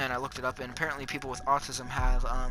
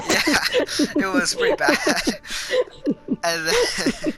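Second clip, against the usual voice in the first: laughing through words; 13 semitones higher, 6 dB louder, slower than usual. Real, not acted.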